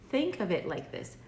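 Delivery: normal talking voice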